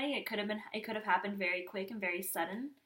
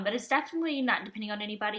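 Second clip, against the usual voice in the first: ordinary delivery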